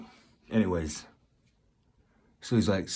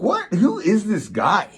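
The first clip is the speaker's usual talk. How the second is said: shocked; 11 semitones higher, 5 dB louder than usual. Retold or imitated. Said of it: "it's like".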